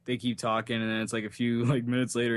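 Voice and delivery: ordinary delivery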